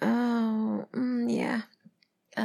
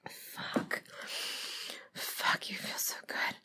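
soft whisper, barely voiced; hushed, intimate whisper; breathless whisper; 7 dB quieter, slower than usual. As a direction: let it out on the breath. Intimate roleplay.

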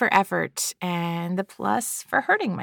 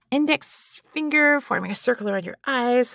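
usual speaking voice

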